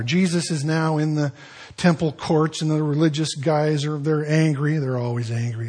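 normal talking voice

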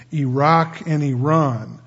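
Iraq and Iran said slowly as "ee-ROCK" and "ee-RON"; news pronunciation. Shown as a demonstration. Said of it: "when we read on the news".

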